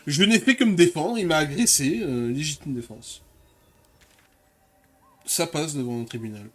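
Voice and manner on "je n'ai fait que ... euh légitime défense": mock self-defence. Real, not acted. Jokingly pleads self-defence.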